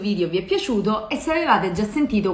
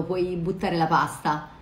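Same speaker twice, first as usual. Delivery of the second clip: ordinary delivery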